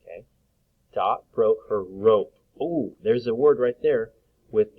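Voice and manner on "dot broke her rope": slow, careful reread; teacher rereading for the class; slow reading aloud. Acted. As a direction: read the sentence slowly again.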